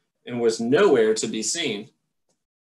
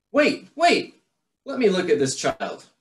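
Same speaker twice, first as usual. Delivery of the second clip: urgent high call; 6 semitones higher than usual. Acted. Mother bat pushes through.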